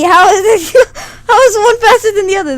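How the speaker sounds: high, loud, laughing protest; laughing disbelief. Real, not acted. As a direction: protest the trick while laughing. Baffled by trick question.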